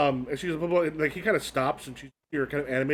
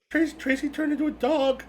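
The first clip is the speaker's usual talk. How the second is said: put-on panicked girl; 10 semitones higher than usual.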